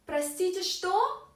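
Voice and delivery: "что" lifted high at the end; rising question. Shown as a demonstration. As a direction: raise the voice sharply on "что". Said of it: "our voice, uh, goes up a little bit".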